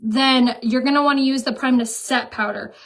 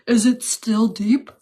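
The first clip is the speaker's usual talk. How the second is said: deeper voice; slower than usual. Pretend. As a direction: put on a deeper, slower voice. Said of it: "Talk in a deeper voice".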